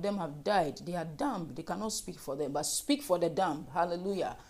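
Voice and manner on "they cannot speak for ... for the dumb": normal talking voice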